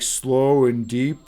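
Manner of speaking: slow deep guru voice in videos. Retold or imitated. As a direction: stretch it out, low. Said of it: "they're all, like".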